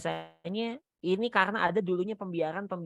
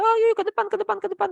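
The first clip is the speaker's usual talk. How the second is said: mock rushed parent, much higher and louder; hurried coaxing; 6 dB louder, faster than usual. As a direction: hustle the child along too eagerly. Parent rushing crying child along. (orang tua terburu-buru membujuk anak, nada tinggi)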